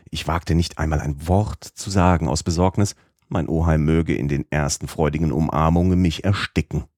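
ordinary delivery, nothing marked

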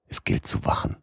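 whispered, barely voiced warning; hushed warning. A narrator's reading. Narrator whispering the danger is close. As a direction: breathe it out as a secret alarm.

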